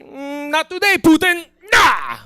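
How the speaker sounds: put-on high, loud Russian-accented dad waving off a caller; father screening calls, high and loud in a Russian accent; dad dodging a call. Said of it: "it'd just be".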